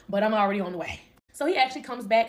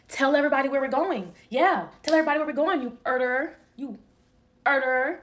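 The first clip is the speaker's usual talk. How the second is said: higher, louder mock accusation; needling, raised-voice taunt; 4 semitones higher, 5 dB louder than usual. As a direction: imitate him goading someone on camera. Imitates him accusing her on camera.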